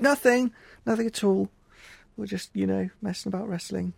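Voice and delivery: high-pitched, hammed-up innocence; innocent child act. Children caught wrestling answer nan, mock-innocent. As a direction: play a child covering up mischief.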